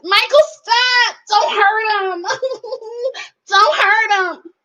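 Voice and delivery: high, hammed-up cry of don't hurt him; mock pleading. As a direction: fake a dramatic plea, voice up high. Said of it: "She wants it".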